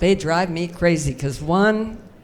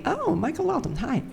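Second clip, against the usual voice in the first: high, soft mocking gush; mock complaining viewer; 8 semitones higher, 8 dB quieter than usual. Viewers complaining about her cleavage, mocked high and soft. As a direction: mimic a scandalised viewer, pitched high and hushed. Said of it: "people were complaining".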